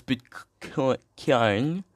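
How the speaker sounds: "Bitcoin" drawn out slowly as misspelt; misspelling sounded out. Shown as a demonstration.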